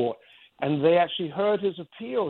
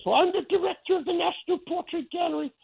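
much higher, mocking Australian accent, haughty and dismissive. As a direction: imitate the Australian director's accent as she turns you down. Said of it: "in her Australian accent".

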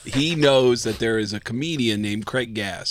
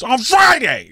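high, loud impatient repeat; insistent repeat; 12 semitones higher, 8 dB louder, slower than usual. Retold or imitated. Tracy repeating himself to young Craig, high and loud. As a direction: bark the day again, louder. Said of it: "He goes".

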